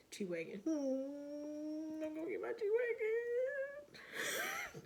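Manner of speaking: high, slow, drawn-out wail; half-laughing mock sob, very high; mock wailing cry. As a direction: wail the wish like a crybaby. Wailing determined self-talk.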